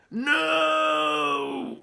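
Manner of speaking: loud high roar. A narrator's reading. Viking crowd rejects lettuce, roaring. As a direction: roar it as a mob. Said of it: "roared the crowd".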